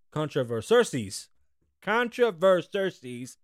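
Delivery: slow, deliberate "controversies"; practising a word. Shown as a demonstration. Host stumbling over "controversies", repeating it slowly. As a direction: sound out "controversies" slowly, syllable by syllable. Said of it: "wait up".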